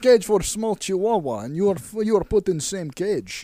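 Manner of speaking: put-on loud comic Russian accent; hammed-up Russian captor; mock Russian accent. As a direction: explain it in blunt Russian-accented English. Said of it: "now you have Russian accent".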